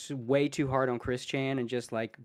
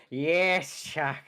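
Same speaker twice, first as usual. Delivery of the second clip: bad Orson Welles; much slower than usual. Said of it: "a really bad, um, Orson Welles impression".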